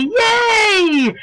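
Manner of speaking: high, drawn-out celebratory whoop; playful cheer. Acted. Lecturer celebrating the designer's new couture house, playful cheer. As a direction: cheer it high, almost squealed.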